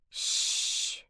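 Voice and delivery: soft, drawn-out shush; hushing. Acted. Sneaking visitor to screaming girl, hushing shush. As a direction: shush her quietly.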